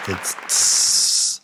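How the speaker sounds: short voiceless hissing tss. Shown as a demonstration. Teacher shows learners the voiceless ending, hissing tss. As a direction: hiss a quick tss with no voice. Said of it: "No, it's nothing".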